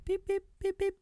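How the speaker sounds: four high imitated beeps; short electronic keypad tones, high-pitched. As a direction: tap out a PIN in high beeps.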